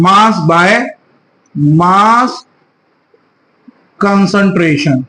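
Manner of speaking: spaced out word by word with long pauses, for note taking; teacher dictating each word slowly; slow dictation. Shown as a demonstration. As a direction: dictate it slowly so the class can write. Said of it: "note down करें".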